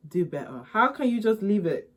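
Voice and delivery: loud, high mock scolding; calling out. Real, not acted. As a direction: call them out, sassy and loud. Calls out viewers, scolding.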